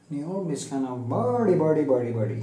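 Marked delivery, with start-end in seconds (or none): 1.1-2.4 imitating Pakistani accent. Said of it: "He talks like this just for fun".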